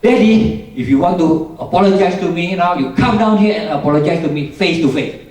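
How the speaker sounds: daughter's loud, high-pitched angry demand; angry shouting. Retold or imitated. Daughter yells from upstairs at Daddy to come down, angry shout. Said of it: "dia teriak".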